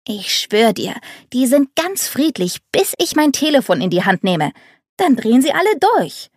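whining. A narrator's reading. Girl complains to her friend on the phone, whiny and high. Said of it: "jammerte sie".

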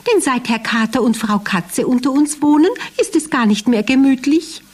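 high squeaky mouse, lamenting; complaining little mouse. Acted. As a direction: whine it like a worried mouse. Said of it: "klagte Zappelschwänzchen".

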